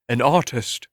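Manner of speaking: usual speaking voice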